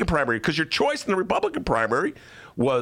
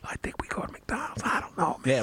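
soft, confidential stage whisper; 2 semitones higher, 6 dB quieter than usual. Acted. Adviser debates lunch with the mayor at council, whispering. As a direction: whisper casually into an ear. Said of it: "chose to whisper".

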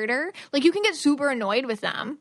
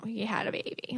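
hushed, breathy whisper; 9 dB quieter than usual. Real, not acted. Telling how her client saw the ex's new baby, hushed whisper. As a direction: whisper it like a painful secret.

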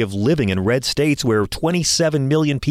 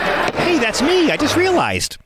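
surprised; slower than usual. Real, not acted. Hears his own name read.

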